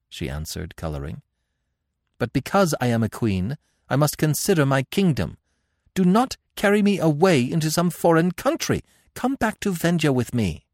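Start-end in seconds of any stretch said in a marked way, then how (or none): 2.2-10.7 high, pleading royal voice; urgent queen, pitched high; captive queen. A narrator's reading. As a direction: plead with your captor as a proud queen.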